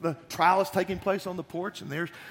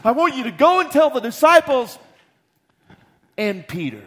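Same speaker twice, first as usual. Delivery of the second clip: urgent command; 9 semitones higher, 14 dB louder than usual. Acted. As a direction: stress the last words tenderly. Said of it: "What he exactly said was".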